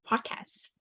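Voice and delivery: ordinary delivery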